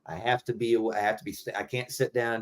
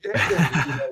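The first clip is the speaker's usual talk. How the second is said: higher, sheepish yeah; meek yeah; 7 semitones higher than usual. Retold or imitated. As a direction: give in timidly. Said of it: "I'm just like".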